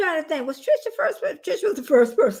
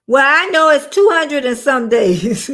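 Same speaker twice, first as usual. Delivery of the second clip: laughing; 8 dB louder than usual. Real, not acted. Laughing at herself.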